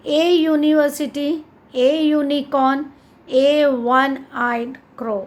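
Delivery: A said clearly as ay before university; A university, A unicorn, each A ay; stress on A. Shown as a demonstration. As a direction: lean on the article A. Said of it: "So A is used before these words".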